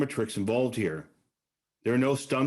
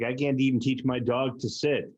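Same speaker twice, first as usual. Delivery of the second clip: ordinary talk, nothing marked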